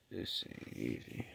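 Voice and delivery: real sick, spent whisper; exhausted whisper. Real, not acted. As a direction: let it fade to a breathless whisper.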